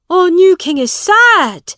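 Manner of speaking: high, loud call from the crowd; man's shout. A narrator's reading. Onlooker remarks on the gloomy king, shouting. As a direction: shout it out for all to hear. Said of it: "shouted one man".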